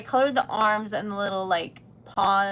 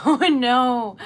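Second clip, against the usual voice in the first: usual speaking voice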